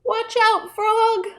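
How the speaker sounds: high-pitched alarmed warning; urgent warning. Acted. Storyteller warns relaxed frog of alligator, alarmed.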